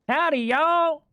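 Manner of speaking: high Texas twang on "Howdy, y'all"; Texas accent. Retold or imitated. Showing friends the Texas accent he loves, high twang. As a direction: sing it out like a Texas woman greeting folks. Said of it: "pure Texas women accent".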